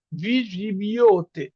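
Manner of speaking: ভি জিবিওতে drawn out very slowly, syllable by syllable; teacher modelling ভি জিবিওতে at half speed; slow demo pronunciation. Shown as a demonstration. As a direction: spell out ভি জিবিওতে slowly for the class. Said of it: "এটার উচ্চারণ হচ্ছে".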